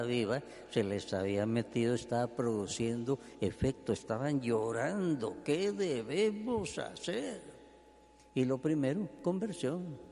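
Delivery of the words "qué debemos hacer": higher, desperate plea, slow on the question; pleading crowd. Acted. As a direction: beg Pedro as a crowd convicted of sin. Weeping crowd asks Peter again.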